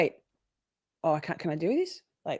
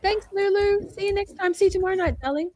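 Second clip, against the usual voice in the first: much higher, sweet sing-song farewell; high sing-song goodbye; 13 semitones higher, 3 dB louder than usual. Pretend. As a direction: trill it like a fond goodbye. Sing-song sign-off to Lulu.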